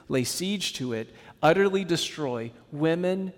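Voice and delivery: ordinary talk, nothing marked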